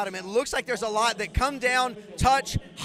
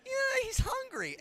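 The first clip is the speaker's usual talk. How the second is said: high-pitched awkward reply; 13 semitones higher, 4 dB quieter than usual. Retold or imitated. Storyteller replays his reply to a shocked woman, in a high sheepish voice. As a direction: squeak it out high, as an awkward excuse.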